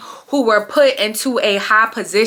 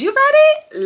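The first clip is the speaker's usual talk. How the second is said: high, louder hype-up; hyped question; 4 dB louder, slower than usual. Real, not acted. Hyping her viewers for the story, high excited ask. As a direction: pump the audience up.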